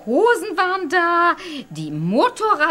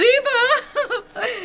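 hearty laugh; 9 semitones higher than usual. Real, not acted. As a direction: laugh out at your own joke. Motor scooter lady laughs at remark.

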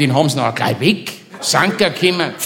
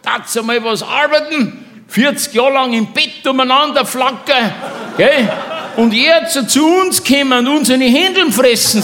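retold shouting; 9 semitones higher than usual. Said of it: "schreit dann rüber".

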